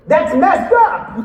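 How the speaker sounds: loud, slow, high indignant outburst; angry, drawn-out cry of protest; upset outburst. Retold or imitated. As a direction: blurt it out, outraged on his behalf. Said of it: "an outburst like that".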